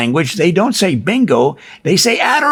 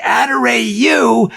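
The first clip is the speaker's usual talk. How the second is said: slow, higher drawn-out Adorayu; caller's reply; 6 semitones higher, slower than usual. Retold or imitated. Harry answers the player from the bingo machine, drawn-out call. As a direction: stretch out Adorayu like an announcer. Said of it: "would say".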